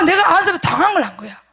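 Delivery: ordinary talk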